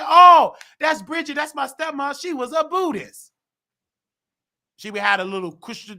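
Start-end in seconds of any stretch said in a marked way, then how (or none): 0.0-3.3 high, excited recognition; like spotting someone you know on TV; high-pitched "aha" moment; surprised realization. Retold or imitated. Sudden recognition while watching TV.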